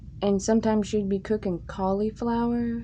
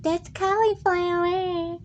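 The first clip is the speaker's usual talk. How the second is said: much higher, slow put-on old lady; grandma voice; 11 semitones higher, slower than usual. Grandma answering about the cauliflower, high put-on old lady. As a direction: play grandma matter-of-fact. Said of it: "she'd be like".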